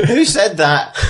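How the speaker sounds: a mild high-pitched challenge; startled character. Acted. Game master repeats character's challenge.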